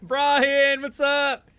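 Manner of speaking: high, shouted hello; loud greeting. Real, not acted. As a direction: yell a greeting across the room. Greets viewer loudly.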